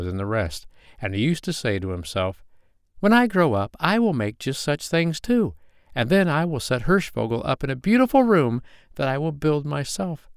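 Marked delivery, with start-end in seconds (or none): 3.0-10.3 put-on higher-pitched child voice, full of plans; a boy's high, determined declaration; eager young boy. A narrator's reading. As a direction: voice a little boy dreaming aloud.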